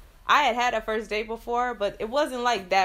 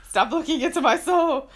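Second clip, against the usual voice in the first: high, loud laughing protest; 7 semitones higher, 6 dB louder than usual. Real, not acted. Laughing mock complaint to boyfriend.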